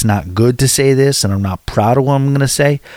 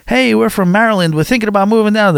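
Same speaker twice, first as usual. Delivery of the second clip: high-pitched, chirpy mock announcement; mock-enthusiastic outsider voice, much higher; imitated eager newcomers; 9 semitones higher than usual. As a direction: pitch it well up like a naive hopeful newcomer. Mimics hopeful newcomers posting online.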